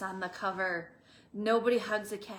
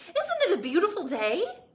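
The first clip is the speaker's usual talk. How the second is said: higher, louder, sunny voice; cheerful; 8 semitones higher, 7 dB louder, faster than usual. Acted. Tumbleweed cheerfully greeting grumpy Hank. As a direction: gush about the lovely day. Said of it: "said Rosie cheerfully".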